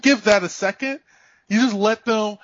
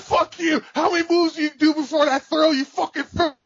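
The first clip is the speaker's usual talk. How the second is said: rage-quitting fighting game player, yelling and near crying; raging yell; 9 semitones higher than usual. Retold or imitated. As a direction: scream at the game like a sore loser. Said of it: "you wanna see me yell".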